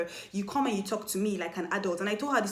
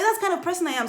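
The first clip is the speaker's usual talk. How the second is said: high and loud, insisting; emphatic retort; 10 semitones higher, 5 dB louder, faster than usual. Retold or imitated. Telling the accuser on the phone, high loud insistence. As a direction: declare it with full force.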